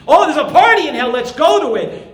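fast, mocking, carefree voice; mock the idea of a party in hell; mocking false teacher. Preacher mocks teachers who promise a party in hell, in a carefree mocking voice. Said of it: "as some people teach".

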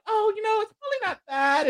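put-on airy Valley Girl reassurance. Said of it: "Amber also has a Valley Girl accent".

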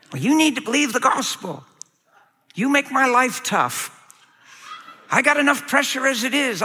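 high, whiny voice of an exasperated husband; overwhelmed caller begging the pastor; complaining husband. Acted. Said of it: "You ever hear anybody talk like that?".

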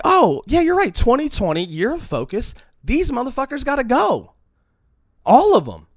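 loud, high, fired-up declaration; pumped-up mock resolution; fired-up resolve. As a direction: vow it like a new-year war cry. Quoting resolute self.

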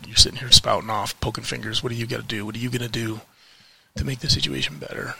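ordinary talk

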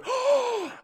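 sharp high mock gasp of shock. Mocking the wildlife officials hearing her podcast, theatrical gasp. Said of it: "listening to my podcast going".

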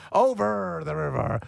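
high, slow pirate voice with long R's. Acted. Host mocking the accent tip, pirate voice. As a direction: stretch the R's like a hammed-up pirate. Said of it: "think you're a pirate if you did that".

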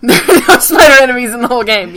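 fast, laughing through the words. Real, not acted. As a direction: chuckle while saying it. Laughing at the joke.

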